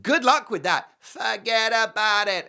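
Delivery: high, loud, drawn-out dismissal; mock dismissive holler. Host scoffing at the idea he slept, mock holler. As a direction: call it out loud, as if waving it away.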